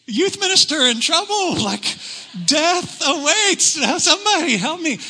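high, loud desperate cry for help; hammed-up yell for rescue. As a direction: call out as if about to fall. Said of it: "So I just start yelling out".

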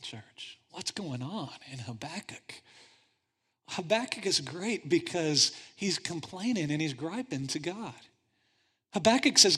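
ordinary delivery

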